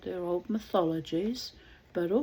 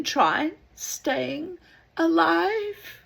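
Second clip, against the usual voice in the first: slow advice; 10 semitones higher, 6 dB louder, much slower than usual. Acted. Diseased man advises.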